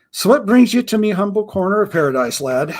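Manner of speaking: a hint of a gruff old pirate, proud of his name; lightly voiced pirate.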